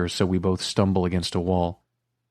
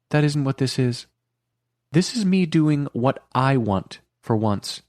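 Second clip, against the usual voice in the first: 1 lightly voiced Carrie; 7 semitones higher than usual. A narrator's reading. Narrator voicing Carrie.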